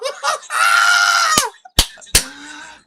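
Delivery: huge high burst of scornful laughter; loud mocking laugh. Real, not acted. Streamer laughing loudly at a caller's question, scornful cackle. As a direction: cackle right in his face.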